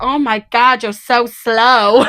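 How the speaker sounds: high, slow mock American accent; drawn-out playful American accent. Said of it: "my really good American accent".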